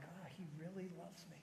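very soft sigh of realization; moved, hushed. Retold or imitated. Son realizes his dad loves him, softly awed. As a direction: let it out quietly, touched.

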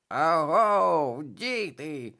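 loud croaked call; croaking raven. A narrator's reading. Абраксас гукає дітям, каркаючи. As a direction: caw it like a raven. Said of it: "прокрякав Абраксас".

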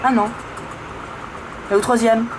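normal talking voice

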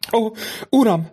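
high, frightened outcry of a mother; desperate cry. A narrator's reading. Frightened mother cries out to the count in despair. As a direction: cry out in terror for her child. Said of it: "kiáltott föl a kétségbeesett anya".

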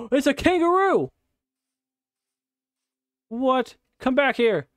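high loud thrilled kangaroo call; excited surprise. Real, not acted. Spotting a kangaroo, thrilled high shouts. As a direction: chase it with delight.

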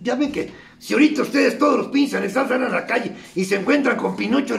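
ordinary delivery

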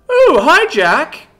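very high, loud, delighted hello; enthusiastic greeting. A narrator's reading. Luvia suddenly spots Jack mid-rant, enthusiastic greeting. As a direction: break off and greet a friend brightly. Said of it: "gave Jack an enthusiastic smile".

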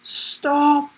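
high, thin, breathless cry; winded wheeze. A narrator's reading. Will winded calls to the galloping giraffe, wheezing cry. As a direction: plead after him with no air left. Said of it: "wheezed Will".

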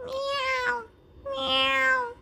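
very high meowing; cat meow. Acted. Narrator voicing the cat, high meow. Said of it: "The cat said".

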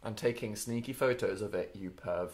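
quiet. Real, not acted. Teasing accusation, dropped voice.

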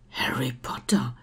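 awed whisper going round; hushed crowd murmur. A narrator's reading. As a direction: breathe the name in a low whisper. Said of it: "raunte es um ihn herum".

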